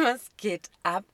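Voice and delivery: exasperated, half-laughing exclamation; loud disbelief. Retold or imitated. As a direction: drag it out in stunned outrage. Outraged reaction to controlling message.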